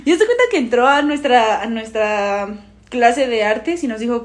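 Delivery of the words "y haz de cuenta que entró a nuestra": ordinary talk, nothing marked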